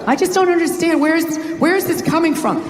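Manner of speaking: whiny, baffled wife; mimicking wife. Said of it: "Whenever she says that to me".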